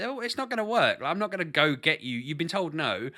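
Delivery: retold parental brush-off; dismissive parent. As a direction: act out a parent unmoved by a bawling child. Parent dismissing a bawling child.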